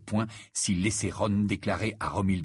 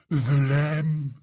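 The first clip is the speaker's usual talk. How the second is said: higher, swooning confession; short of breath; 6 semitones higher, 6 dB louder than usual. A narrator's reading. Ron confesses love to Harry, breathlessly swooning. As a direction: declare love as if winded by it. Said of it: "murmura Ron, le souffle court".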